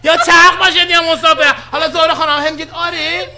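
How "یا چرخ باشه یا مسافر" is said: loud, high mock announcement; playful shout. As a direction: boom it out jokingly, higher up. Teasing shout about spinning trip.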